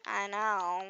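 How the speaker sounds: normal talking voice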